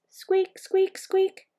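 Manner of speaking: very high, tiny squeaky voice; hamster squeaks. A narrator's reading. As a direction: squeak the word like a small animal. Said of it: "All she heard was".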